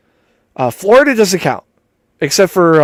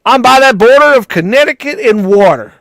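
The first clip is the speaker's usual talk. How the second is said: higher, hammed-up Connecticut accent; put-on Connecticut accent; 6 semitones higher, 4 dB louder than usual. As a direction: play a Connecticut local, hammed up. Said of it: "From Connecticut".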